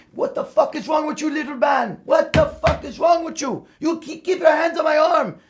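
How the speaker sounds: imitating an angry, high-pitched tirade; high-pitched retold rant at an underling; mad bodybuilder. Angry bodybuilder scolds his little spotter over slipping hands, mad. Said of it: "he would get fucking mad".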